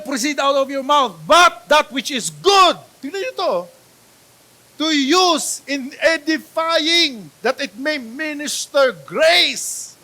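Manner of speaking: scripture reading. Acted. Preacher reading Ephesians to congregation, slow declaiming. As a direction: proclaim each phrase slowly. Preacher reads verse aloud.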